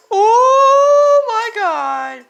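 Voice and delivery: long drawn-out high shout of shock; slow, loud, stunned cry; real shocked shout. Real, not acted. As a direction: stretch it out, yelling in disbelief. Shocked reaction to cartoon.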